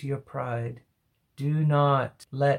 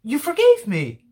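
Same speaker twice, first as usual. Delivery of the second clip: much higher, louder, rushing confession; amazed psalmist; 7 semitones higher, 4 dB louder, faster than usual. Acted. Psalmist telling God he held his sin, high and fast. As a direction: pour it out like a forgiven man. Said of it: "it's almost like stammering".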